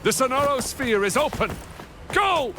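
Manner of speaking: high, fast gleeful announcement; triumphant cry. Acted. Villain gloating to Rover as the sphere opens, high triumphant cry. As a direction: throw the gates open in triumph.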